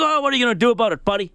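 high, fast, mock-defiant challenge; frustrated retort. Golfer talking back to the pastor, frustrated and defiant. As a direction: fire it back like an annoyed golfer. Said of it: "the guy's frustrated".

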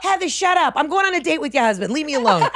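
high, mock-imperious mother; bossy mom. Mom dismissing her daughter to date the husband, high bossy voice. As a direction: shoo her off like a diva mom.

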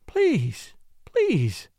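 lightly acted plea; imploring. Woman begging him not to climb down, pleading. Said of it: "she implored, wringing her hands".

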